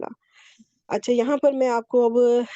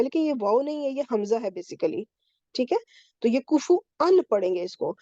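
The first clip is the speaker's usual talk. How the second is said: normal talking voice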